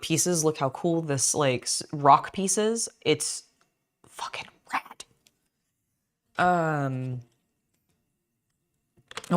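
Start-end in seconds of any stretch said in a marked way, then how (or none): 4.1-5.0 unvoiced whisper of awe. Real, not acted. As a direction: hush it out, impressed. Admiring a cool Lego piece.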